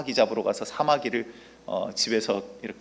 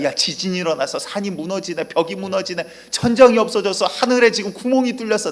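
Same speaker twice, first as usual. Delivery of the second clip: panicked ant; 6 semitones higher, 5 dB louder than usual. Acted. Ants panic at earthquake.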